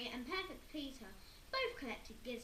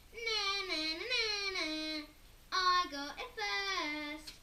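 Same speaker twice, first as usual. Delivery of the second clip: sing-song high, loud taunt; Henry taunting his brother; 7 semitones higher, 7 dB louder, slower than usual. Acted. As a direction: gloat like a bratty kid.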